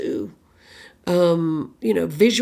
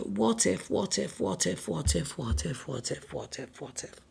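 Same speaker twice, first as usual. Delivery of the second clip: fading, ever softer repeated murmur; anxious looping worry; 4 semitones lower, 11 dB quieter than usual. Acted. Mind looping on the worst case scenario, quiet fading worry. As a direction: let the repeats sink away almost to nothing.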